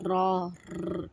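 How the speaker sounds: را stretched very slowly with a full, heavy ر; teacher isolating the ر sound; pronouncing ra. Shown as a demonstration. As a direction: draw out را and then a bare ر, slowly. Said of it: "یہ sound آنی چاہیے".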